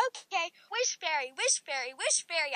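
mild read-aloud scream; understated storybook calling. A narrator's reading. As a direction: chant it as a half-hearted scream. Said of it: "I screamed at the top of my lungs".

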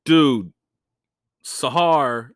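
ordinary delivery

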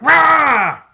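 high, loud drawn-out bear roar. Acted. Bear shot a second time, huge roar. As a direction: let out a big comic bear roar. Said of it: "The bear's like".

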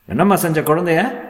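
high, sharp accusing question; accusing doctor. A narrator's reading. Doctor asking mother what she did, sharp accusation. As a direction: confront her, pitch jumping up.

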